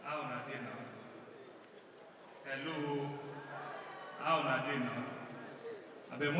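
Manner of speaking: low, distant speech voice; deep, far-off politician address. Acted. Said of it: "impersonating a politician addressing citizens".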